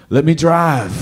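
half-awake confused demand; groggy voice. Retold or imitated. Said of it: "he's like".

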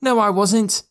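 high, indignant denial; protesting. A narrator's reading. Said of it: "Joe protested".

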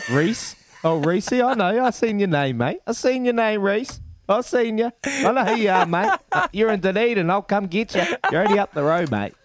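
mock threat. Mock threatening a flirting caller.

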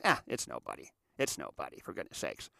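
low, dropping mutter of disinterest; imitated letdown, sinking low and quiet; dismissive. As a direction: write them off under your breath. Mimics diners losing interest.

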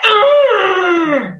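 high, loud frustrated groan; exasperated groan. Retold or imitated. Angry great grandmother groaning in frustration. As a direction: let out a fed-up ugh. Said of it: "just that".

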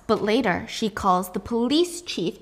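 normal talking voice

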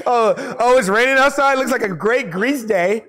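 high, delighted mock daydreamer; mock Grease fan. Mocks playing Grease on rainy day.

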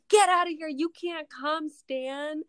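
high, loud yell to send someone away; exasperated shout. Acted. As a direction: holler it in acted exasperation, stretching the words. Acted quarrel, Jesse shooing Stan.

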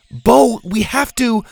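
high, loud, drawn-out urging; urgent. A narrator's reading. Zeta tries to reason with Bo, urgently. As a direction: cut through a friend's panic. Girl urges panicking friend.

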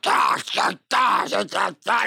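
high wordless grunts in a character voice; grunting impression. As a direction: grunt like a man who barely speaks. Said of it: "my favorite is him in Affliction".

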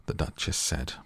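usual speaking voice

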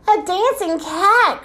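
high, slow exclamation; delighted cry. A narrator's reading. King Oswald watching Busby spin in the butter, delighted cry. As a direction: cry it out with amazed glee. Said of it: "cried O-, King Oswald".

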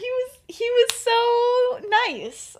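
mock gushing. Lily gushing fake praise of Vernon to Petunia, high and slow. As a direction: gush falsely, stretching it out.

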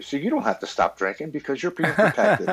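mocking addiction voice breaking into a laugh; laughing tempter voice. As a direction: chuckle through the lie. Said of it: "my mental illness and addiction steps in and says".

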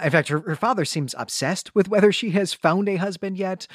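usual speaking voice

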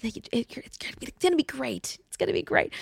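put-on whispered reassurance to a scared child; soothing hushed mom. Mother whispering to her crying daughter at the preschool door, soft coaxing. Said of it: "But I'm like".

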